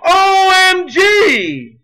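high, drawn-out shouted letters; mock cry-out. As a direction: shout each letter slowly as a mocked cry for help. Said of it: "you are not going to cry out".

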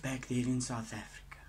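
hushed, breathy plea; whisper. Acted. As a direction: whisper it like a secret memory. Cousin pleads about the old farm.

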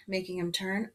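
ordinary delivery, nothing marked

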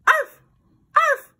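two high, loud yips; happy dog barks. A narrator's reading. Lassie shakes hands with Susan, barking happily. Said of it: "Lassie barked happily".

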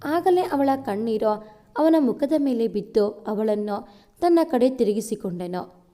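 ordinary delivery, nothing marked

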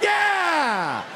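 high, drawn-out whoop; acted cheer. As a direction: cheer like a relieved busload. Acts passengers cheering the escape.

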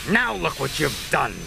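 a lightly wheezy cross engine; wheezing Gordon. A narrator's reading. Gordon scolding Percy after the crash, lightly wheezing. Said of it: "he wheezed".

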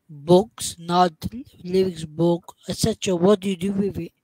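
ordinary delivery

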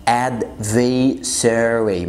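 adversary very slow, the first R dropped; drawn-out adversary, final R kept; adversary without middle R. Shown as a demonstration. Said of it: "ما تنطقش أول R".

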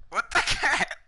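high squeaky what the heck; squeaky exclamation. Real, not acted. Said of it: "that sounded disgusting".

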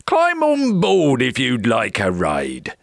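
hearty pirate invitation; pirates crying out. A narrator's reading. Pirates invite Jack onto the ship, pirate cry. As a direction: call him aboard in a pirate voice. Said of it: "the pirates cried".

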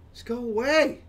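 high, loud shooing shout; exasperated yell. Real, not acted. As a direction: shout at a pest to leave. Exasperated at a fly in house.

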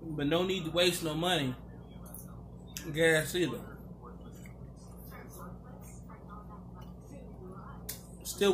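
talking between bites; chewing while speaking; mouth full. Real, not acted. Seen 2: holds loaded fork, bites at 1.8, keeps talking while chewing.

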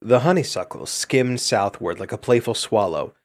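ordinary delivery, nothing marked

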